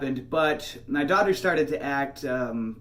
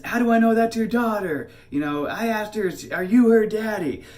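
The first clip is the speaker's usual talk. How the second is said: loud, high mimicry of an accusing woman; retold shouted accusation, pitched way up; screaming lady; 9 semitones higher, 7 dB louder than usual. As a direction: imitate a woman yelling across a distance. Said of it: "this lady's screaming at me".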